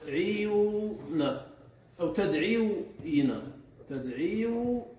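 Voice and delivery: تدعيون and تدعيوين drawn out slowly, a long ʕii before the ending; slow, halting teacher's breakdown of تدعيوين; syllable-by-syllable derivation. Shown as a demonstration. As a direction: sound out تدعيوين in separated pieces. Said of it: "ننقل كسرة الواو إليها".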